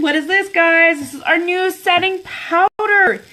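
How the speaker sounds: excited reveal. Acted.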